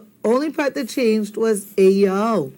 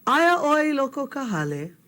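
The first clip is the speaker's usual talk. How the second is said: "Aia oi i loko ka hale" said higher, as a question; question tune; 6 semitones higher than usual. Shown as a demonstration. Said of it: "say it like a question".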